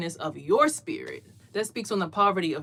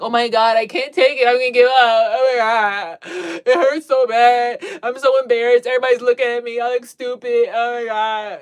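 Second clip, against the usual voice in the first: loud, high mocking wail of someone who can't take pain; mock whiny complaining; 3 semitones higher, 10 dB louder than usual. Mocking people who can't handle pain, loud whiny wail. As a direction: ham up a crybaby who gives up at the first pain. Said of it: "makes you feel like".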